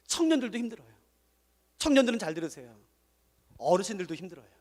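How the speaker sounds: ordinary delivery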